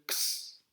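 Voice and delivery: X pronounced as a sharp "ks". Shown as a demonstration. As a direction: say X only as "ks", no voicing. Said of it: "ça se prononce que". (x prononcé « ks »)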